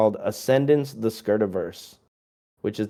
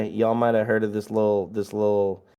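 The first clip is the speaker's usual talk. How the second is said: usual speaking voice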